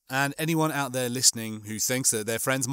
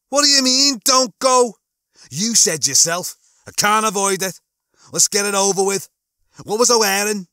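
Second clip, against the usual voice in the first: high, loud Scouse outburst; worked-up Liverpudlian, pitched high; agitated Lister character; 8 semitones higher, 7 dB louder than usual. Acted. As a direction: protest loudly in a raised Scouse voice.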